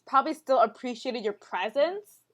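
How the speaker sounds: ordinary talk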